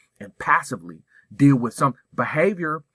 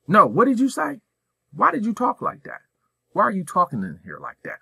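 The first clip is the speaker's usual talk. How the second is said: much higher, pointed questions to a student; direct confrontation; 8 semitones higher than usual. Shown as a demonstration. Principal demonstrating confronting a student, direct and pointed. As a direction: call the kid out on it, face to face.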